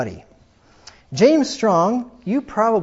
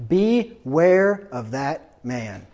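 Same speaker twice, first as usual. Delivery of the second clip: slow warning; 4 semitones lower, much slower than usual. Shown as a demonstration. Repeated warning, slowed down.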